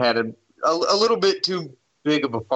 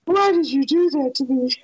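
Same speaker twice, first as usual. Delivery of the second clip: ghostly woman voice; 13 semitones higher than usual. Retold or imitated. Voicing the naked ghost women.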